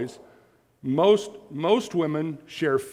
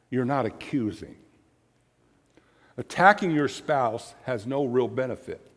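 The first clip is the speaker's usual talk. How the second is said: ordinary delivery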